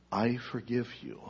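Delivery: soft, low and tender; gentle voice. Acted. God's voice answers the prisoner, soft and tender. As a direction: reassure him quietly. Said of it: "And a voice said".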